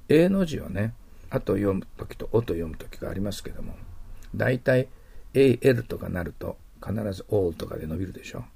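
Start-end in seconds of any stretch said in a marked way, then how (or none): none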